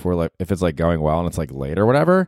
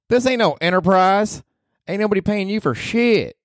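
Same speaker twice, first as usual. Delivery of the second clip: Ruth from Ozark; 13 semitones higher than usual. Acted. Podcaster voicing Ruth, cursing at him, brash and high. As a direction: snap it like a foul-mouthed hillbilly girl.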